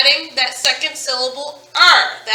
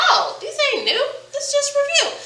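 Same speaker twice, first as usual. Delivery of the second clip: much higher, light laughing singsong; mock kid voice; 13 semitones higher, 4 dB quieter than usual. Teacher voicing students shrugging off review, high and laughing. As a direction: play a kid brushing it off as old news.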